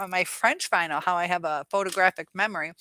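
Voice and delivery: normal talking voice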